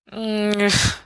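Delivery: a small, miserable moan. Acted. Sirius after the kiss, a miserable murmur. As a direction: groan it out in misery. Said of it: "Sirius says miserably".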